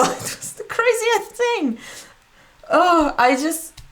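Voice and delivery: laughing, up high on craziest; giddy disbelief. Real, not acted. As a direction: gush about it, still amazed. Reliving meeting her idol.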